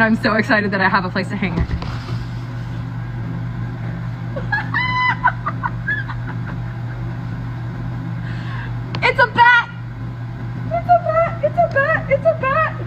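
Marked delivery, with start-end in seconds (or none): 1.7-9.0 airy high-pitched laugh, no voice; breathless high laugh. Real, not acted. Seeing the bat painting, breathless delighted laugh. 9.1-12.8 high squealed delight, said over and over; excited squeal. Real, not acted. Seeing the bat painting, repeated high excited squeals.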